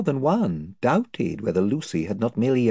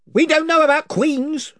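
higher, blustering chief; invisible chief; 6 dB louder than usual. Acted. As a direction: boast of his band's numbers.

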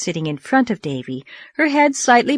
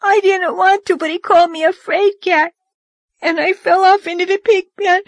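put-on high wailing child voice; 12 semitones higher, 5 dB louder than usual. A narrator's reading. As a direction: wail it like a sobbing child. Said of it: "wailed Dora".

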